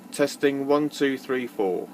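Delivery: ordinary talk, nothing marked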